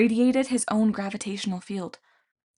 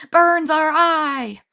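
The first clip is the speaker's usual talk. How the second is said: high, loud, slow pained howl; monster shriek; 8 semitones higher, 8 dB louder, much slower than usual. A narrator's reading. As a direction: wail it like the light hurts. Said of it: "the Cyclopes shrieked".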